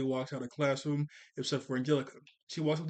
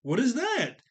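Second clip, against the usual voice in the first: surprised Tommy; 8 semitones higher than usual. Acted. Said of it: "Tommy goes".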